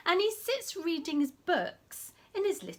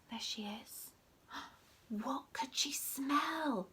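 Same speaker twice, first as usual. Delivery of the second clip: whisper; 8 dB quieter than usual. Acted.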